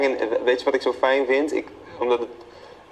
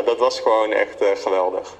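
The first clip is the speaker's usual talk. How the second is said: usual speaking voice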